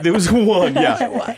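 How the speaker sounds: high, laughing agreement. Real, not acted. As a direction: still giggling. Still laughing.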